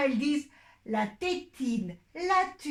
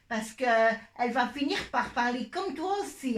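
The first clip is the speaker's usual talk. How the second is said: ordinary talk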